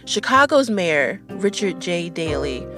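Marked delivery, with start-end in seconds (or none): none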